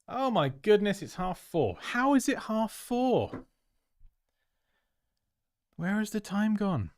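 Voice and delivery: high, drawn-out disbelief; astonished at the clock, pitch leaping up; real surprise. Real, not acted. Streamer suddenly noticing it is half four, astonished. Suddenly sees how late it is.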